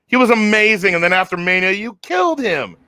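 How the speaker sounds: high, exasperated fan protest; retold outrage. Fan protesting to the bookers about Drew, high-pitched outrage. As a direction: imitate a fan's indignant complaint. Retold reaction to a ruined wrestler.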